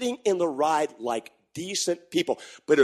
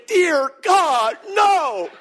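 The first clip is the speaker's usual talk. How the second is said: mock terror; 8 semitones higher, 5 dB louder, much slower than usual. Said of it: "I'm thinking".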